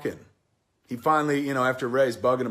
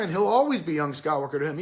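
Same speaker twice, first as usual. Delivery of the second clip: ordinary talk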